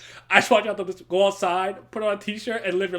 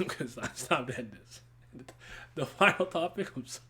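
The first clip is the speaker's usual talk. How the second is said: ordinary talk, nothing marked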